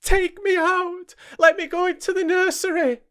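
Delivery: put-on high, pleading child's cry; frightened cry. Young Jane begs the maids to free her, high frightened cry. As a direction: beg to be let out of the room. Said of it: "was my cry".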